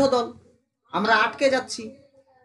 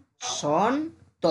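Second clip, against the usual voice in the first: ব-সন-ত split into three slow beats, ব then সন then ত; slow syllable by syllable; 2 semitones higher, much slower than usual. Shown as a demonstration. Teacher splitting Basanta into syllables for a student, very slowly. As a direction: break Basanta apart slowly, each syllable alone. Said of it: "এটাকে ধীরে ধীরে উচ্চারণ কর।".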